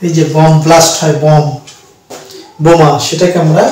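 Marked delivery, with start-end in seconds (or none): none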